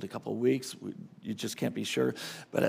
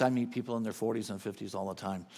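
ordinary talk

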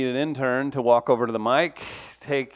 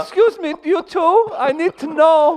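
very high, louder put-on voice; high-voiced visitor; 13 semitones higher, 6 dB louder than usual. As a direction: interrupt as a squeaky, polite stranger. Plays a high-voiced foreign visitor.

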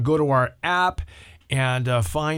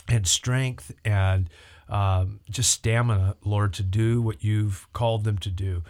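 praying; 4 semitones lower than usual. Acted. Leads a prayer on air.

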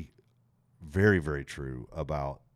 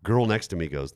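ordinary talk, nothing marked

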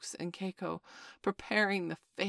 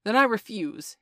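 loud, higher defiant cry; 5 semitones higher, 11 dB louder than usual. Acted. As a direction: cry it out boldly. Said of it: "he cried".

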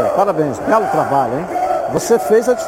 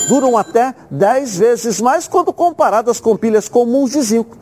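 live TV sponsor pitch; hard sell ad. Acted. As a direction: recommend the product like a salesman. Host reads battery sponsor ad.

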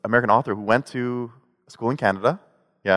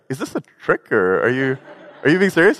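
higher, half-laughing disbelief; puzzled reply; 4 semitones higher than usual. Retold or imitated. Answering the brother, higher and half laughing. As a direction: ask if it's a joke, amused. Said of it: "I was like".

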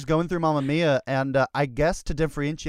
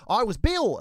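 Australian Bill; 7 semitones higher, 4 dB louder than usual. Retold or imitated. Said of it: "Bill as Australian".

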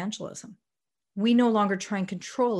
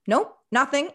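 breezy mock reply; 7 semitones higher, 5 dB louder than usual. Mock answer from God.

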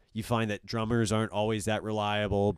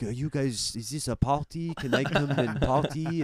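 higher-pitched put-on French accent; 5 semitones higher than usual. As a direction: play a French guy asking to join the party. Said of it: "pretending to be French".

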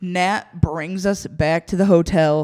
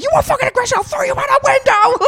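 much higher screechy threat; retold meltdown; 3 dB louder, faster than usual. Guest threatening clerk through door, high furious yelling. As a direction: rage at the top of the range. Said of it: "I'm not proud of the meltdown".

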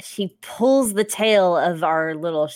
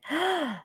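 gasp; 8 semitones higher, 6 dB quieter than usual. Acted. Vivica spots three apes.

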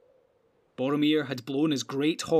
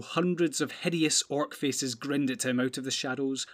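ordinary delivery

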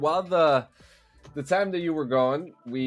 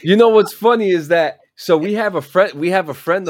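loud; 7 semitones higher, 11 dB louder than usual. Real, not acted. Excited loud reaction.

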